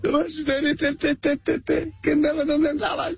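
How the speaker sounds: very high stuttering fake wail; hammed-up sobbing that breaks down into voiceless squeaks; mock crying. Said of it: "estoy llorando mucho". (berrinche lloroso fingido, muy agudo)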